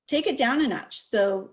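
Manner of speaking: normal talking voice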